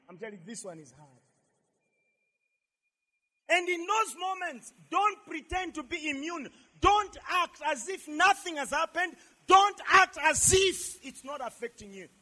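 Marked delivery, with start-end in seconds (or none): 3.5-12.1 forceful, raised exhortation; preach. Real, not acted. Pastor urges congregation not to pretend to be immune, forceful preaching. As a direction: drive each warning home to the congregation.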